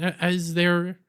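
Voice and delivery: ordinary talk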